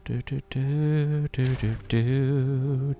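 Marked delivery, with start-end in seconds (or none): none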